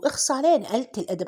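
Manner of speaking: usual speaking voice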